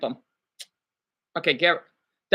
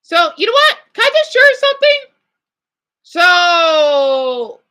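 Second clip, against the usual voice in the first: much higher and louder, bursting to share; eager burst; 7 semitones higher, 5 dB louder, slower than usual. Real, not acted. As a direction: blurt it out, can't hold it in. Excited urge to share news.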